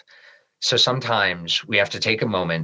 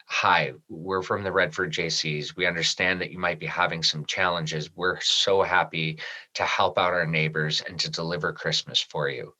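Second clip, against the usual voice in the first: lower, much softer, slowing down; quiet, emotional recollection of the sick mother; hushed and moved; 6 semitones lower, 6 dB quieter than usual. Real, not acted. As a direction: let the words sink as the memory hurts. And I started crying right there.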